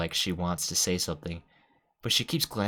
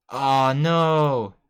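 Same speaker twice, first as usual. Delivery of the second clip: loud, high, drawn-out wail; dismayed cry; 5 semitones higher, 8 dB louder than usual. Real, not acted. Player seeing a character run out of the room, loudly dismayed. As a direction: call out in big dismay. Player reacts as Natsuki runs out.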